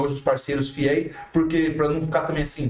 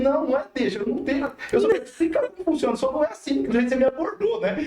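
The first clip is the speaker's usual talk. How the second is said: higher-pitched, insistent mock protest; retold protest; 8 semitones higher, faster than usual. Musician retelling how they answered her casual booking request, protesting. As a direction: push back, reenacting your own objection.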